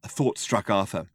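ordinary delivery

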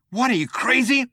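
high, incredulous outburst; alarmed objection. A narrator's reading. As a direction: object in disbelief. Alarmed objection before impact.

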